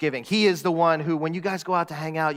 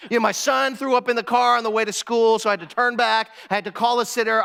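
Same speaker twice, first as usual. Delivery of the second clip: incredulous, higher-pitched comeback into a rushed complaint; mock overwhelmed coworker, pouring it out; exasperated rant; 4 semitones higher, faster than usual. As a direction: vent the bad morning, speeding up as it runs on. Fed-up coworker snaps at a greeting.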